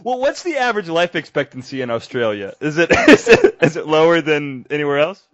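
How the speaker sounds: high, loud laughing burst; laughing question. Real, not acted. Host teasing the Australian guest, laughing. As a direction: laugh through the joke question. Host jokes about danger.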